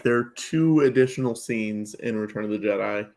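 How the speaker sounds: usual speaking voice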